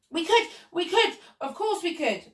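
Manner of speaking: high, overlapping-style eager assent; eager shout. A narrator's reading. Cleaners accept the apple-picking job, eager shout. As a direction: shout yes with high excitement. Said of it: "we all shouted".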